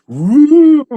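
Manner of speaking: high whooping cheer; excited whoop. Retold or imitated. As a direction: whoop like a party vampire. Quoting the vampire scene.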